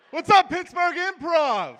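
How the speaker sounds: big, high, slow crowd greeting; hype shout. Real, not acted. Comic greeting the crowd with a slow hyped shout. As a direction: roar it out to the whole room.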